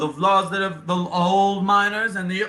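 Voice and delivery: put-on grave dwarf explaining the old laws; Italian accent. [name] explaining dwarf laws of war, earnest Italian accent.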